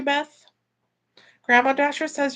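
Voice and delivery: usual speaking voice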